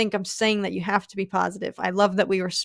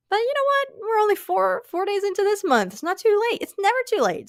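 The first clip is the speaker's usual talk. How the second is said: high, fired-up encouragement; rally them, pitch jumping up high; eager hype about goals; excited pep talk; 10 semitones higher than usual. Real, not acted.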